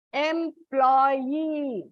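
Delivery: "Employee" in separate syllables, final "ee" held long. Shown as a demonstration. Teacher showing children the last syllable, "Employee" split with a long ending. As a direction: draw out "Employee" as em-ploy-eee. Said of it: "When you pronounce it is".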